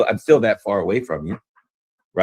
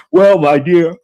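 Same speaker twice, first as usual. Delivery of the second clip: loud yawn through the words; 9 semitones higher, 10 dB louder, slower than usual. Real, not acted. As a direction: talk through a big yawn. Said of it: "I'm yawning a lot".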